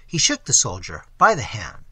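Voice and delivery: ordinary delivery, nothing marked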